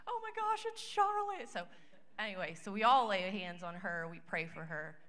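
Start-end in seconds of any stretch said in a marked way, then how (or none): 0.1-1.5 very high, loud gasp of joy; sister-in-law realizing the dream came true; overjoyed exclaim. Retold or imitated. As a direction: squeal it in amazement. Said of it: "she's crying".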